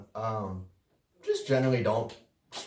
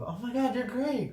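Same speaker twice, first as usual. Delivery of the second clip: much higher, sugary mock sweetness; fake nice girl; 14 semitones higher than usual. Mimicking the fake nice coworker, high mock-sweet voice. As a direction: gush like a fake friend. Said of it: "super fake nice type".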